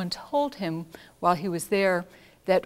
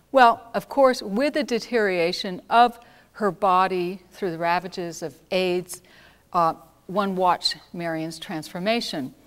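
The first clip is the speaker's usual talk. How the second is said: usual speaking voice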